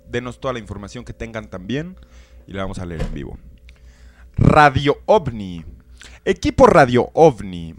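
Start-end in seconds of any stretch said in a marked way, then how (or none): 4.4-7.7 lectura solemne. Acted. Lee en voz alta una carta.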